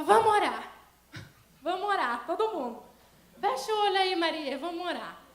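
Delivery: loud, pleading urge to pray together; urgent call to pray. Retold or imitated. Mother urging prayer in crisis.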